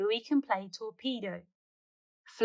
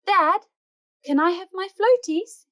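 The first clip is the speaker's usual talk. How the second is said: much higher, louder little voice; small child asking; 10 semitones higher, 8 dB louder than usual. Acted. Bingo to Dad wanting floaties, high loud little voice. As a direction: plead like a tiny pup.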